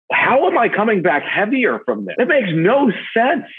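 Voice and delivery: high-pitched, baffled complaint; exasperated disbelief. Acted. Baffled at coming back heavier from a ten-mile day, exasperated disbelief. Mock bafflement at own weight gain.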